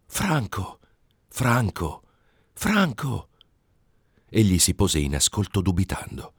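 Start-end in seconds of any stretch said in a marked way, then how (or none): 0.1-3.3 three high, calling cries of a name, rising toward the last; high calls. A narrator's reading. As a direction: call the name from far off, high. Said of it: "tre alti gridi che lo chiamavano".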